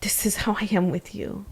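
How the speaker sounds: soft, hushed and tender; gentle voice. Retold or imitated. Wife retelling how the Lord spoke to her, softly and gently. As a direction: breathe it out gently, like a kind whisper. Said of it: "the Lord so gently being like".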